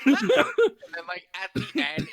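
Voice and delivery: laughing through the words. Real, not acted. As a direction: giggle out the setup. Laughing while describing.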